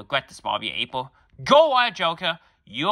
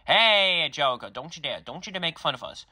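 ordinary delivery

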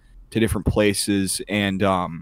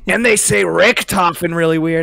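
higher, louder Trump voice; Trump impression; 8 semitones higher, 5 dB louder than usual. Still playing Trump to his friend, Trump voice. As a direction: keep the boastful Trump act.

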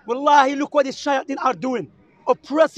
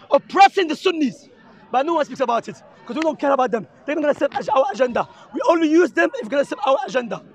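normal talking voice

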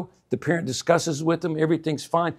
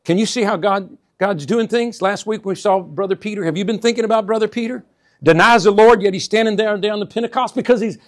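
normal talking voice

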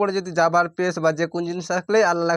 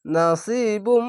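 slow demo reading; 3 semitones higher, much slower than usual. Shown as a demonstration. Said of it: "teacher reads the word slowly".

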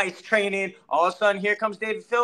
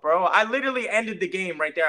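usual speaking voice

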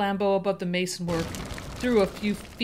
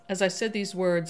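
usual speaking voice

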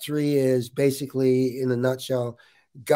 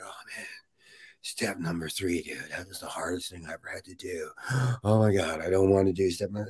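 mock dreading newcomers; 8 semitones lower, 5 dB quieter than usual. Mimicking recovery group members dreading step three, low and soft groaning. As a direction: mimic the people moaning about step three. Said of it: "these people would be like".

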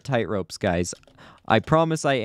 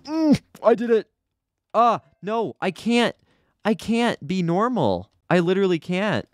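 high, helpless protest; losing it a little, voice high; overwrought whine; 7 semitones higher than usual. Real, not acted. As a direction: whine in exasperation, pitched up. Overwrought while struggling in the game.

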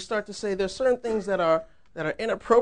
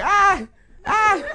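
loud, high mimicked childlike cries; Miss Connie; 13 semitones higher, 7 dB louder than usual. Grown woman acting like a kid during worship, imitated loud high cries. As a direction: blurt out high, toddler-like "ah"s. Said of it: "during worship she's going".

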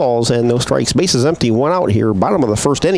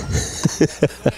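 soft laugh; 2 semitones higher, 6 dB quieter than usual. Real, not acted. Laughs at turning down mic.

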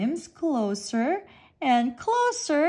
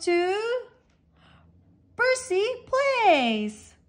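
announcer; 6 semitones higher, 4 dB louder than usual. A narrator's reading. Announcing winners.

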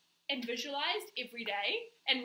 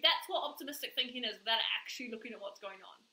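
ordinary delivery

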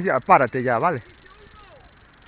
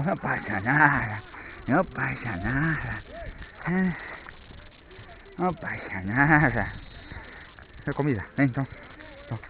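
reassuring; 3 semitones lower, 10 dB quieter than usual. Real, not acted. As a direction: soothe the dog gently. Calming the dog after mistake.

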